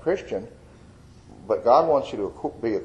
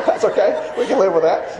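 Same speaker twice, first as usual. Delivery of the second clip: higher and louder, still laughing through the words; 9 semitones higher, 5 dB louder than usual. Real, not acted. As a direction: talk through the tail of the laugh. Still laughing after the joke.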